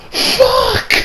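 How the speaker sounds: very high, strained cry; despairing mock scream. Fan learning the death is permanent, high despairing cry. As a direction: cry it out in hammed-up horror.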